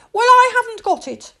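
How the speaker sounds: put-on high loud indignant child; defiant princess. Princess argues with the doctor, high loud defiance. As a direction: stand your ground like a cheeky little girl.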